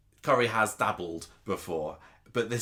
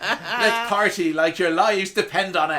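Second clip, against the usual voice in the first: high, loud, slow laughing Irish impression; laughing mock Irish accent; fake Irish accent; 12 semitones higher, 9 dB louder, slower than usual. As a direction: belt it out in a hammed-up Irish voice. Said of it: "fake Irish accents".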